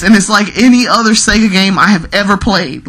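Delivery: ordinary talk, nothing marked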